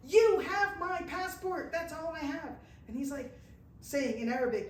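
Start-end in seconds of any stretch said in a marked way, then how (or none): 0.1-2.5 high, loud and slow, insisting; exasperated protest. Retold or imitated. Pilgrim to the giant soldier, high, loud, exasperated. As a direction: insist with rising frustration. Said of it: "And I'm like".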